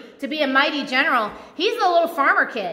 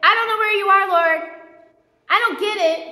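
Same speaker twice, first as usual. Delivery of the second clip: higher, louder, bewildered protest; raised voice of honest doubt; frustrated prayer; 5 semitones higher, 4 dB louder than usual. Retold or imitated. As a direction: tell God you just don't get it. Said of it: "who you could say".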